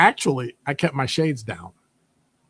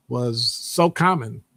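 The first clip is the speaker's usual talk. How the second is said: ordinary talk, nothing marked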